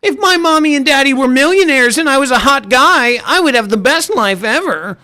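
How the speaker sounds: high, mocking spoiled-kid voice; millionaire wannabe. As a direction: imitate a whiny mommy-and-daddy wannabe. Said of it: "mommy, daddy, millionaire vibes".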